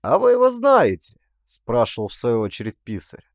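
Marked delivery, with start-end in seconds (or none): 0.0-1.2 much higher, louder surprised ask; surprised question. A narrator's reading. Clerk asks the narrator if he knows him, surprised. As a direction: sound startled they know him.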